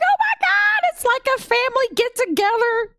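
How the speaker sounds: very high-pitched gushing; overjoyed fan squeal. Retold or imitated. Acting out excited fan reaction.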